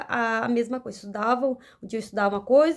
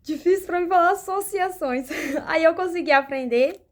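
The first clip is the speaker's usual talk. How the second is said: laughing; 6 semitones higher, 3 dB louder than usual. Real, not acted. Laughing at her own stumble.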